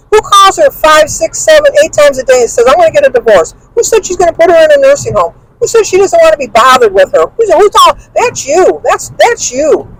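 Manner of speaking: high-pitched indignant rhetorical questions; worked-up retelling of a row; retold angry tirade. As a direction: re-enact firing accusing questions at him. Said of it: "I was so mad at him".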